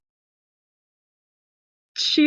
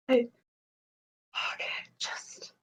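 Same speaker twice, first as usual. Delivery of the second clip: whisper; 11 dB quieter, much faster than usual. Real, not acted. Player mutters to herself.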